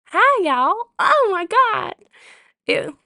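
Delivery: high, loud flirty Southern drawl; flirty Southern accent. Shown as a demonstration. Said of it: "The flirty southern accent".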